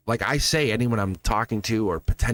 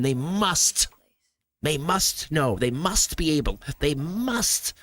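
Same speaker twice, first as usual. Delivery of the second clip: lightly voiced fretful insistence, a touch higher; mock anxious insisting; 3 semitones higher, slower than usual. Mocking a worried dater who insists they must. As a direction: imitate someone convincing themselves they must. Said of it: "When you start going".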